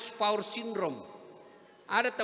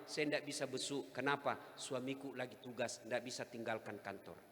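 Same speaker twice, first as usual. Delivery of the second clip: quiet wife quoted; 7 semitones lower, 9 dB quieter than usual. Retold or imitated. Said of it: "dia bilang apa?".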